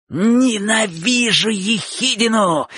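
high, drawn-out cry of fury; hateful shout. A narrator's reading. Bocho shouts hatred and rushes at Chik. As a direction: stretch it out as you lunge. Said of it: "крикнул Бочо и ринулся".

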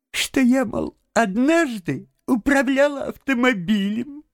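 high, pleading put-on lady's voice; babbling timidly. A narrator's reading. Toad as washerwoman begs to steer, timid high babble. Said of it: "и пролепетал".

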